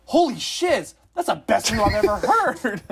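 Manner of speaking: high, loud, gleeful quote; a high loud mock thrill; excited friend. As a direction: blurt it out like the best news ever.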